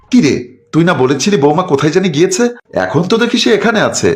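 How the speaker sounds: high, chiding mother's voice; mother scolding son about the wife; reproachful mother. Acted. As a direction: catch your son out in a lie.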